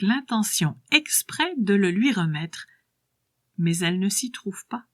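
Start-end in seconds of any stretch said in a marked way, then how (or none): none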